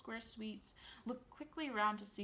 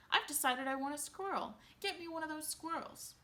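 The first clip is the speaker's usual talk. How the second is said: put-on spoiled demanding girl; 8 semitones higher, 4 dB louder than usual. A narrator's reading. Veruca demanding.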